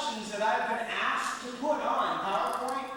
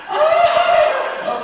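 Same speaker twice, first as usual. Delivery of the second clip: sudden high whooping cheer, much louder; self cheer; 14 dB louder than usual. Shown as a demonstration. Speaker cheering for himself at assembly, whooping yell. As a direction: let out a big solo cheer. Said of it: "I always cheer for myself like".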